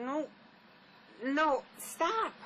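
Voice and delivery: sudden loud high cry of stop; frightened plea rising to a shout; panicked no. Acted. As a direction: push him off with your voice. She pushes him away.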